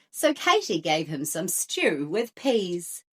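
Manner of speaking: plain reading voice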